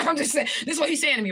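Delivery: high laugh breaking into fast talk; real laugh, quick words. Real, not acted. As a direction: laugh it off and rush on. Laughing at her own story.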